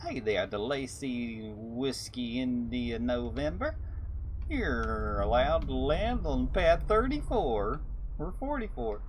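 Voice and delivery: mock Southern radio lady, slow and lilting; slow Southern twang, lifting at the end; Southern drawl. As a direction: lightly imitate a Texan traffic controller. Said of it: "there was a lady that came on the radio that was Southern".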